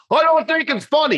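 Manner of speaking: very high put-on protest; mock upset objection. Upset mourner objecting to comedy, mock distressed.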